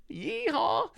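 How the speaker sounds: light Western holler, half-joking; cowboy yeehaw. Pretend. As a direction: throw in a cheerful cowboy yeehaw. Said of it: "I can say yeehaw".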